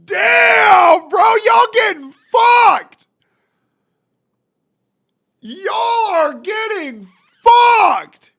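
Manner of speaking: very high, loud, drawn-out shout; outraged yelling. Real, not acted. Streamer shocked at viewers' minimum wage, yelling. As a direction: yell each word long and high.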